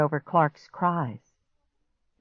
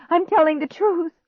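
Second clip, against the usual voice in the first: high, insistent plea; pleading; 13 semitones higher, 4 dB louder than usual. A narrator's reading. Girl pleads with doubting stepbrother, desperately. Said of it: "I pleaded".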